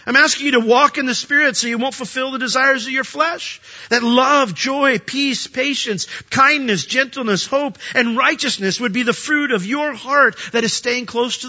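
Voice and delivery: earnest, high-pitched pulpit appeal; forceful preaching. Real, not acted. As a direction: urge the congregation, driving each point home.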